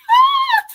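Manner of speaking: sudden high, loud laughing shout; loud burst of laughter. Real, not acted. Host bursts out laughing at the guest's line, loud and high. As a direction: break into a loud whooping laugh.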